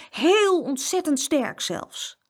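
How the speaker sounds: high, drawn-out boast; emphatic little-boy bravado; tough. A narrator's reading. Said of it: "zei hij stoer".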